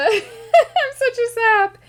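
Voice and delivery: high, slow teary laugh; real happy tears breaking into laughter; laughing through tears. Real, not acted. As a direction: laugh at yourself while crying a little. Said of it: "I'm tearing up".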